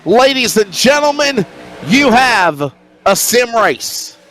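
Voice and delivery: high-pitched, stretched-out announcer proclamation; race-start announcer. Real, not acted. Commentator telling ladies and gentlemen the race is on, high announcer call. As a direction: proclaim it grandly to the crowd.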